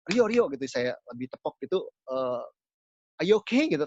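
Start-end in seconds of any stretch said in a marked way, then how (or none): 0.1-0.5 calls the name loud and higher, trying to rouse him; louder call. Retold or imitated. Pastor calls a collapsed youth louder by name. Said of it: "lebih keras". 3.2-3.7 higher, louder call to wake someone; urgent call. Retold or imitated. Pastor shakes the limp youth, calling loudly.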